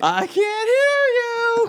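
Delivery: very high, slow stretched call; mock cheer demand. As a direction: drag it out like a cheer. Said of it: "cheerleader call again".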